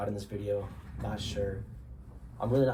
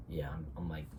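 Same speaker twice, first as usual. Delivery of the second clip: congested; 4 semitones lower, 8 dB quieter than usual. Real, not acted. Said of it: "I'm, like, congested and shit".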